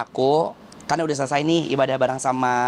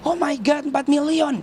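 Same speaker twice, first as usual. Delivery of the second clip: much higher, gasping oh my God at the follower count; mock a stunned fan reading the numbers; amazed fan; 13 semitones higher, slower than usual. Stranger stunned by the celebrity's follower count, high amazed voice. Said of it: "katanya gitu".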